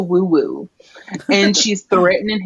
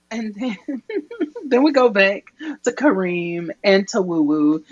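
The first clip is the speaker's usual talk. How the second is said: usual speaking voice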